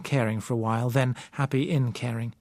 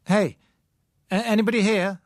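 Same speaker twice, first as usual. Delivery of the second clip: calling out; 9 semitones higher, 5 dB louder than usual. A narrator's reading. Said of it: "I called".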